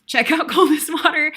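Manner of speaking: laughing through the correction. Real, not acted. Laughs at own mix-up.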